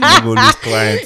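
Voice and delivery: high teasing shout; playful shout. Real, not acted. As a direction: call it out laughing, slow. Joking shout to friend.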